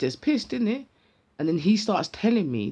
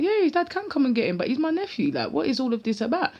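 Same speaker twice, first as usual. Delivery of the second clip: indignant; 8 semitones higher than usual. Retold or imitated. Retorting to the policeman.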